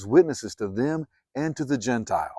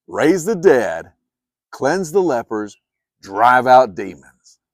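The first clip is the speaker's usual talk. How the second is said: slow proclaiming; 2 semitones higher, 9 dB louder, slower than usual. Acted. Jesus sending the twelve out, slow ringing commands. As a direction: raise each command louder and slower.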